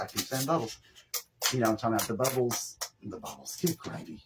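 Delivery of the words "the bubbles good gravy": a hushed good gravy to herself; whispered exasperation. Real, not acted. Crafter whispering to herself over a wrong word. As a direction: whisper it under your breath, fed up. Muttering at her own word slip.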